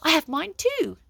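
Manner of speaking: high, loud proud announcement; proud declaration. A narrator's reading. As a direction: call it across the shop. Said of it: "declared Bonehead".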